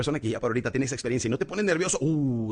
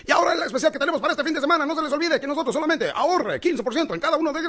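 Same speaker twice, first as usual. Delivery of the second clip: fast, much higher, loud ad-reader voice; hyped TV announcer; 5 dB louder than usual. Retold or imitated. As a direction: rattle it off like a pushy TV ad. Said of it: "salen los anunciadores". (locutor de comercial acelerado, agudo y fuerte)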